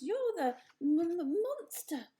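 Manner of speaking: stammering, slowed gasp of fright; a touch of startled fear. A narrator's reading. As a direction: stutter out the word in alarm. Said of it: "the opossum gasped".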